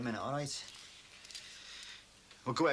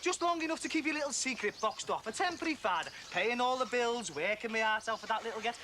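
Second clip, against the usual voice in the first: raised, higher-pitched furious taunting; bitter accusation; 10 semitones higher than usual. Acted. Husband accusing his friend of fathering his son, furious and pitched high. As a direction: jab every line at him with scorn.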